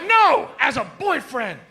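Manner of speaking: high, loud, drawn-out no; exasperated correction. Acted. Friend exasperated at misunderstanding.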